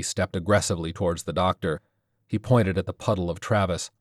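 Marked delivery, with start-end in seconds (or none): none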